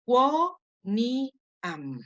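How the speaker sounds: "quo, ni, am" said as three separate syllables. Shown as a demonstration. Teacher showing students how to split the word, syllable by syllable. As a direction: break "quo, ni, am" apart, one syllable at a time. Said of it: "La divido".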